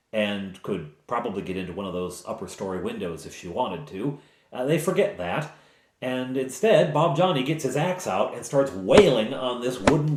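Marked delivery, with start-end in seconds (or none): none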